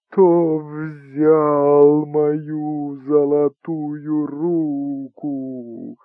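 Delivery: very slow, plaintive, reproachful howl; wailing ghost voice. Acted. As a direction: wail it mournfully, full of reproach. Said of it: "провыть эту фразу особенно жалобно и укоризненно".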